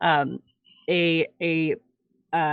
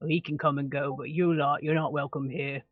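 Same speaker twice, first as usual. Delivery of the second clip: softer, lower half-orc voice; half-orc dismissal; 3 semitones lower, 6 dB quieter than usual. Acted. Half-orc rejecting the human, low and cold. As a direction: coldly turn him away. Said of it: "he kind of, like".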